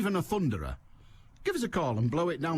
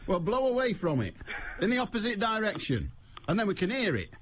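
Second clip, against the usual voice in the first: exasperated; 8 semitones higher than usual. Real, not acted. Exasperated instructing the caller.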